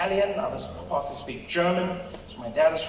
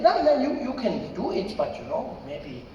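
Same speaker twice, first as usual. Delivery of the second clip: put-on halting German-accented reassurance; Rolf; 5 semitones higher than usual. German friend reluctantly letting him try, German accent. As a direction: trail into doubt with a German accent.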